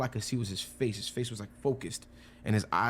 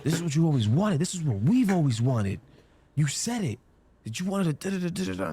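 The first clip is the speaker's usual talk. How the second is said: acted anger, pitched up and insistent; imitated angry outburst; 6 semitones higher than usual. Angry friend confronting him after his no, retold angrily. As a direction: throw the accusation back, higher. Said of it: "when he would get angry".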